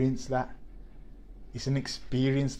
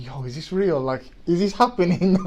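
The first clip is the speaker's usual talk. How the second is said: retold disbelief; 6 semitones higher, 8 dB louder than usual. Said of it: "I'm thinking".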